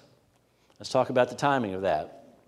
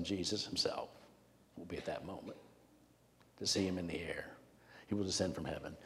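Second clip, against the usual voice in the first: low and very soft, near whisper; hushed reverence; 5 semitones lower, 14 dB quieter than usual. Real, not acted. Preacher imagining first sight of Jesus, in a hushed near-whisper. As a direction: drop right down to a hush.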